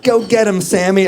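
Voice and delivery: high, loud mom voice egging him on; mock-excited mother cheering. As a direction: squeal it like a proud mom.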